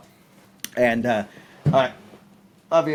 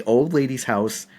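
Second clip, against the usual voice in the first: ordinary talk, nothing marked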